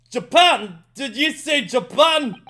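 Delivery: loud, high, slow outraged governor; Armstrong voice. Acted. Governor shocked the lawyer is from Japan, loud outrage. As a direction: bellow it in disbelief. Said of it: "my best Armstrong voice for him".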